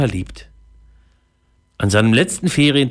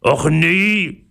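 higher, drawn-out moan of dismay; groaning; 7 semitones higher than usual. Acted. Third pirate despairing in the storm, groaning. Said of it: "stöhnte Säbelzahn".

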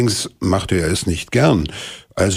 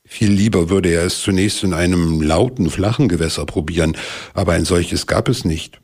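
ordinary delivery

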